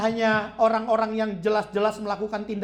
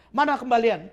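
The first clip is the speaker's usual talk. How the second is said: louder, demanding snap for the change; nagging husband; 2 semitones higher, 7 dB louder than usual. Retold or imitated. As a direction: bark at her for the change. Stingy husband nags wife.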